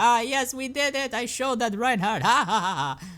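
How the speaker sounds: high, gleeful mad scientist crowing; Junkrat, all happy. Acted. Junkrat triumphant over Reinhardt, hammed-up glee. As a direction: crow it high, breaking into a cackle. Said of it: "Junkrat's all happy".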